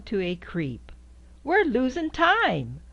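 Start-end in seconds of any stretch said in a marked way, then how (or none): none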